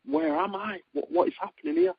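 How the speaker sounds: high, wide-eyed disbelieving voice; amazed disbelief. Retold or imitated. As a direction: marvel at being there, pitch way up. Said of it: "and I'm like".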